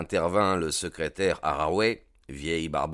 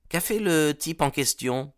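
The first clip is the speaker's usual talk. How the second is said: put-on young Wilson asking; 9 semitones higher than usual. A narrator's reading. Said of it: "s'enquit le jeune Wilson".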